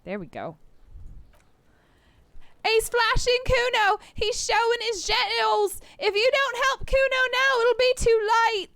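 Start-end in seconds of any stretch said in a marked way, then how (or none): 2.7-8.7 high, loud fake cry for help; bratty kid yelling. Kuno yelling to passersby, high mock alarm. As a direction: shriek it like a brat faking distress.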